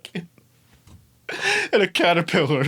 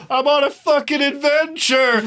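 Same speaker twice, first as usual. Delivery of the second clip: much higher and louder, thrilled outburst; excited exclamation; 11 semitones higher, 5 dB louder than usual. Retold or imitated. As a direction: shout it like a kid on a quest. Retelling his own excited shout.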